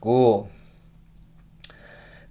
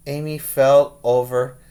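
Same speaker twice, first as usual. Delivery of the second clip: ordinary delivery, nothing marked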